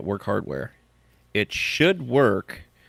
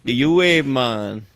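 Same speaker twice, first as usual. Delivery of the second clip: louder, higher hammed-up country accent; 5 semitones higher, 6 dB louder than usual. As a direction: bellow the name like a country yokel. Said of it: "just stop with the accent".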